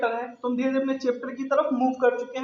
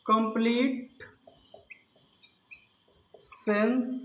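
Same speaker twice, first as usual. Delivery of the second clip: one word at a time, long pauses; halting word-by-word teacher dictation; slow dictation; much slower than usual. Shown as a demonstration. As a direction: dictate as if writing each word out. Dictating definition while writing it.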